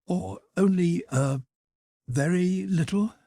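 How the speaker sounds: very drawled out high; drawn-out high very. A narrator's reading. Anna playing down the ball to Dolly, high drawl on very. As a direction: stretch very high and long. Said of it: "drawling out the word very in a high-pitched voice".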